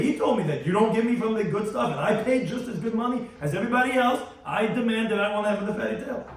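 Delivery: angry customer demanding the best cut; indignant demand. Retold or imitated. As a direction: insist you paid full price and want the fatty tail.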